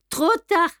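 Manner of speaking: high-pitched, emphatic cut-off; triumphant taunt. A narrator's reading. Clerk blocking Beautrelet at the window, taunting. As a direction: crow that escape is blocked. Villain blocks the last escape.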